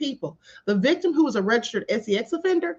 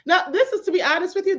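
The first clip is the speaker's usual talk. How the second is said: normal talking voice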